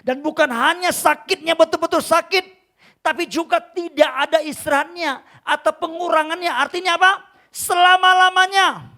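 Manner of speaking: loud, high-pitched sermon delivery; impassioned preaching. Acted. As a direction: preach it forcefully, stretching the final word. Preaches at full force.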